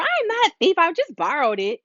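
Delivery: high mock child protest; child defending themselves to a parent; kid talking back.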